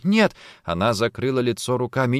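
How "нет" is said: high, loud refusal; horrified cry. Acted. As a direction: cry out in anguish. Horrified denial, covering her face.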